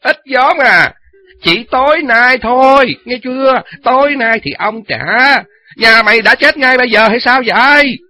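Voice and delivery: loud, high furious yell. Acted. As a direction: roar it at her. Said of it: "hắn quát lên".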